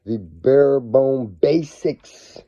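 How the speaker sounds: ordinary delivery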